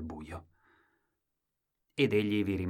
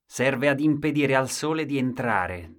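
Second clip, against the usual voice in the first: firm stubborn reply; 5 semitones higher, 6 dB louder than usual. Acted. Husband answers wife stubbornly.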